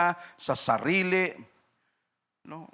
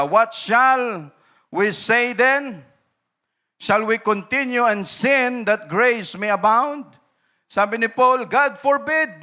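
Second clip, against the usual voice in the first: high, loud, slow Bible reading; forceful scripture reading; 6 semitones higher, 6 dB louder, slower than usual. Real, not acted. Preacher reading Paul's words to the church, high and loud. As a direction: proclaim the verse slowly and loud.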